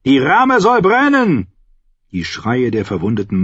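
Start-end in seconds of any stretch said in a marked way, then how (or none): 0.0-1.5 high, drawn-out shouted order; calling out. A narrator's reading. Commander wants the ram burnt, calling out. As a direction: shout it to the archers. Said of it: "rief er".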